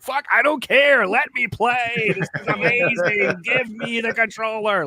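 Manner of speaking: high, slow, desperate pleading. Retold or imitated. Kid begging stepbrother to let him play, high desperate plea. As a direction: beg for the controller like a desperate kid. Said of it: "Like, I just was desperate".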